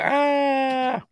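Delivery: high squeal of fright; a quick fake scared shriek; mock scream. Spider prompts a squeal.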